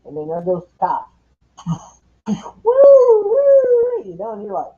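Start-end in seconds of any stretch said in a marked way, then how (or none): 2.6-4.0 high, loud woo-woos, laughing; excited whoops. Retold or imitated. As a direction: whoop like watching a car chase. Retelling a police chase.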